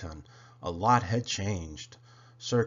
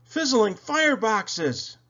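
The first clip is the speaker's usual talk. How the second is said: high, loud, slow astonished cry; a little engine's startled, high cry; gasped exclamation; 10 semitones higher, 5 dB louder, slower than usual. A narrator's reading. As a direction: gasp it out in amazement. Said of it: "Then Thomas gasped".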